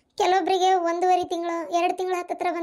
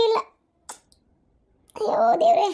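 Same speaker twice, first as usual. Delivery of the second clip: anguished lament; 3 semitones higher than usual. Acted. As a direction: wail it out in despair. Mother laments daughter's pregnancy news. (ಸಂಕಟದ ಗೋಳಾಟ)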